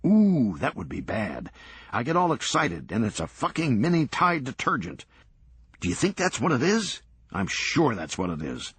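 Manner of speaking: high, swinging from glee to mock fury; an excitable man talking himself up and down; manic Serge. Serge's manic monologue on the box.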